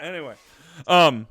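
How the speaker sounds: ordinary talk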